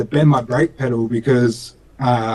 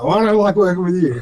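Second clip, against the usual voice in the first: higher-pitched comeback with a laugh in it; mock retort; 5 semitones higher than usual. Son answering dad while building the car, laughing retort. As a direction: throw it back, half laughing. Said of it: "I'm like".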